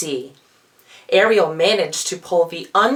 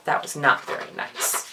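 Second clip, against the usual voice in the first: soft whispered comment, much softer; whispered aside; 4 semitones lower, 6 dB quieter than usual. Real, not acted. Reader judging the king's temper to listeners, whispered. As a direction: murmur your disapproval under your breath.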